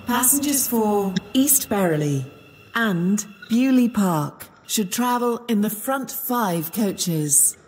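ordinary talk

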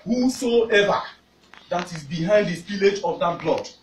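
fiery sermon, rising to shouts; preaching. Acted. Preacher exhorting congregation.

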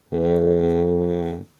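long, held dinosaur bellow; sustained hollow dinosaur call; tuba-like bellow. A narrator's reading. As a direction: hoot one steady note like a tuba. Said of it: "a deep bellowing sound, like a tuba".

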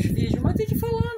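high, loud insistence; emphatic. Real, not acted. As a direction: insist you must talk. Emphatic about talking.

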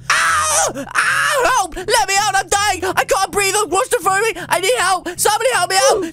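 high, frantic cries for help; play-acted screaming desperation; hammed-up panic. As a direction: wail as if trapped and dying. Screams for help, trapped inside.

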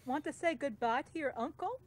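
normal talking voice

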